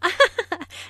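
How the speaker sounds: short high-pitched laugh; bright burst of amused laughter; friendly laugh. Acted. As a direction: laugh lightly at a customer's joke. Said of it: "The receptionist laughs first".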